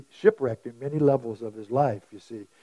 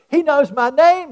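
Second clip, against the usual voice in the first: put-on high, loud excited child; 10 semitones higher, 6 dB louder than usual. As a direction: blurt it out thrilled. Child's excited first take.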